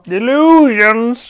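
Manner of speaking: high, loud, stretched-out wail; drawn-out theatrical cry. Acted. Interrupting co-host with a film quote, theatrical cry. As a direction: belt it out in mock-dramatic style.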